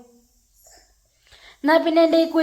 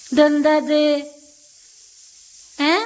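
normal talking voice

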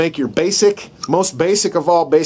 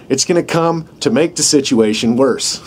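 ordinary talk

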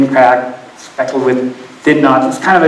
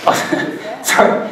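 ordinary talk, nothing marked

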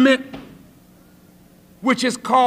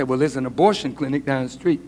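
advising friend; 13 semitones lower, 6 dB quieter, faster than usual. Acted. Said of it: "you tell your friend".

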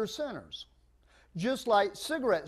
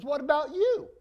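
pitched up, eager question; high curious voice; 9 semitones higher, 3 dB louder than usual. Acted. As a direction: ask it bright and high like a little bill. Bill asks the other in joke.

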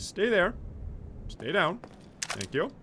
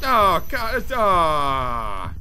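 groaning death throes; 2 semitones higher, 4 dB louder, much slower than usual. Real, not acted. Dying in game.

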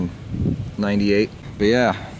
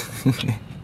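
soft chuckle; 3 semitones higher, 7 dB quieter than usual. Real, not acted. Amused by old car hardware, soft chuckle. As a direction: chuckle quietly to yourself.

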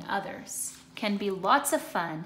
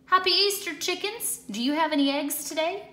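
much higher, bright cheery cat voice, fast at the end; cheerful Pete greeting; 7 semitones higher, 4 dB louder, faster than usual. Acted. Pete greeting the chickens, high cheerful call. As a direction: call a happy hello to the chickens. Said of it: "Pete asked".

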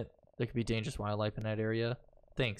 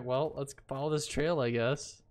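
ordinary delivery

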